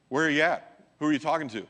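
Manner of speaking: usual speaking voice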